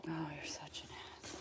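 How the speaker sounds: fast muttered whisper. Real, not acted. Owner whispering an insult at her dog. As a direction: whisper the insult under your breath.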